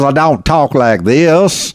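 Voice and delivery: a light Southern drawl, slowed right down. Shown as a demonstration. Arkansas host demonstrating the drawl he doesn't have, slow. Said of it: "with a drawl".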